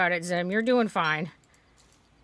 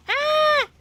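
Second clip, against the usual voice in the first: high shriek of alarm; panicked scream; 4 dB louder than usual. Real, not acted. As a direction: scream as you get caught. Character dies.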